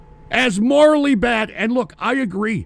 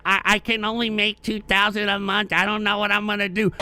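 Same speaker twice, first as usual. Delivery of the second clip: softer, whiny mock voice of a complainer; mocking whiner; 4 dB quieter than usual. Streamer mocking jobless men complaining on Twitter, whiny mock voice. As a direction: sneer at them by whining their words. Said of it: "that are complaining on Twitter".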